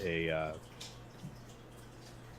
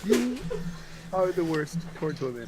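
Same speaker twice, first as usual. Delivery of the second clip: high put-on voice; 10 semitones higher than usual. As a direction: scold in a high character voice. Put-on voice insult.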